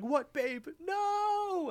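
very high, drawn-out cartoon wail; mock desperate cry. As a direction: imitate being hauled away in protest. Retold being dragged away by police.

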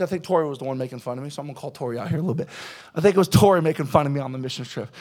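fast mumbled aside. Real, not acted. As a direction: rattle it off under your breath. Quick aside, teasing.

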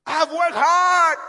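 high, called-out protest; aggrieved guilt-tripping. Acted. Elijah trying to make God feel bad, aggrieved. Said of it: "trying to make God feel bad".

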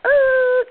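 high, loud imitated screech; one held squeal, far above talking pitch; sudden loud effect noise, not a word; sound-effect noise. Shown as a demonstration. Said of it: "hard for me to do something like".

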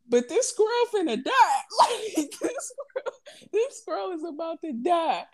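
high-pitched comic wail with laughter; half-laughing, exaggerated high lament; mock distress. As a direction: lament it like a hammed-up cry. Mock distress over the doomed squirrel.

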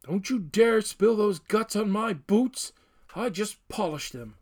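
loud, high, fussy outcry; crying out. A narrator's reading. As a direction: shriek it in prissy disgust. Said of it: "Lewis cried out".

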